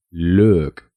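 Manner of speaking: slow model of look; English pronunciation. Shown as a demonstration. Teacher models the word for learners, slowly. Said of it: "se pronuncia".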